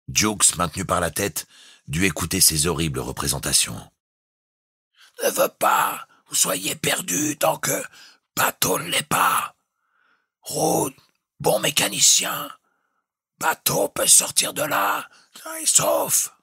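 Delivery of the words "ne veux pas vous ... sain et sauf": high, strained, broken shouted phrases; captain shouting in storm. A narrator's reading. The captain shouts clipped orders to Jukes in the storm. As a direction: fight the wind to be heard.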